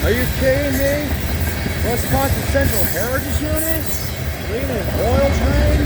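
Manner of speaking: high, quick replay of the disbelief he felt; retold excitement. Railfan retelling his reaction to the camera, replayed excitement. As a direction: relive that stunned moment for the viewers, quickly. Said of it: "That actually kinda shocked me".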